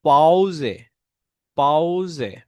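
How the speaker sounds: পাউজে said slowly, syllable by syllable, in German; modelling German P word. Shown as a demonstration. Teacher drilling পাউজে for students, slow careful German. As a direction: spell out পাউজে clearly for learners. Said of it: "পে হচ্ছে".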